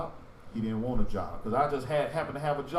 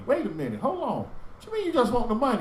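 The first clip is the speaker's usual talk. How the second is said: retold disbelief; 9 semitones higher than usual. His own retold disbelief.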